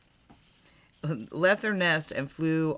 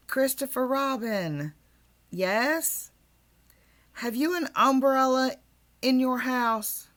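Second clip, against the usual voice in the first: lightly raised voice of a bear calling down from above; a hint of a small bear calling from the sky; Pooh calling down; 7 semitones higher, 4 dB louder than usual. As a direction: call down to the boy from up high.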